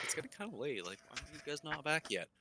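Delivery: imagined butcher. Retold or imitated. Player imitates butcher waiting on late hunters, wondering aloud. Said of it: "I'm sure the butcher's always like".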